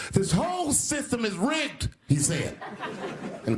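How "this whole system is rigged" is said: retold scream. Comic retelling a billionaire's debate outburst, high mock scream. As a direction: imitate an outraged billionaire's scream at a high pitch. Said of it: "screaming at the top of his lungs".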